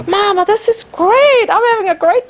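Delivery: put-on high, fast happy kid voice; a kid gushing from the bridge; delighted child. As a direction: voice the thrilled son. Son's happy reply quoted.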